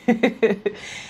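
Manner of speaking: short nervous laugh at grim news; uncomfortable laugh. Real, not acted. Ranting about Griner, an uneasy helpless laugh. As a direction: laugh because nothing else helps. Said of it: "I'm not laughing because it's funny".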